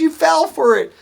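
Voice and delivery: high-pitched mock gloating; teasing. Boss teases new hire who fell for prank, imitated high teasing.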